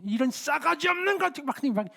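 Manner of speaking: high, shouting scold of a furious father; angry old father yelling. Retold or imitated. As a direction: bark at your daughter like an enraged old man. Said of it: "그 막 소리 막 치시는데". (화난 아버지의 고함)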